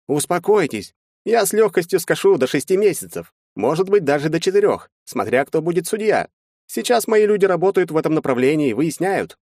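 high-pitched, animated lawyer; lawyer character. A narrator's reading. Lawyer laying out the defense to his clients, high animated lawyer voice. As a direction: voice a lawyer reasoning in high, lively tones.

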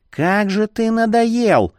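high, drawn-out exasperated cry; fed-up, high-pitched complaint. A narrator's reading. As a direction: throw up your hands in annoyance. Fed-up clerk loses patience.

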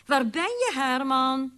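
high-pitched wifely call. A narrator's reading. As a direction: call out searching for your husband. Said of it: "riep ze".

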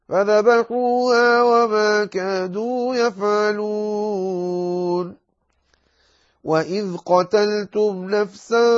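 slow, melodic tajweed chant; Quran recitation. Acted. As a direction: recite the verses with long held vowels.

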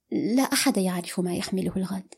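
usual speaking voice

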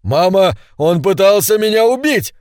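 высоко и громко, с дрожью; закричи испуганно матери; дрожащий крик. A narrator's reading. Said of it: "закричал Бун дрожащим голосом". (Бун жалуется матери: дрожащий высокий крик)

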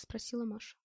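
ordinary delivery

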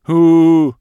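loud high whining hoot "у-у-у"; creature whine. A narrator's reading. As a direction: whine like an upset little beast. Loxr shakes head, whining. (жалобный вой лохра)

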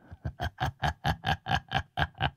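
low sinister villain cackle; a wicked villain's laugh. Shown as a demonstration. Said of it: "luyện được ba cái điệu cười phản diện".

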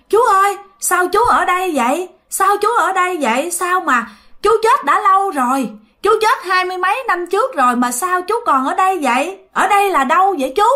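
high-pitched, incredulous questions; acted astonishment at seeing the dead; bewildered questioning. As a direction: press for answers in disbelief, pitch held high.

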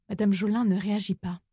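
usual speaking voice

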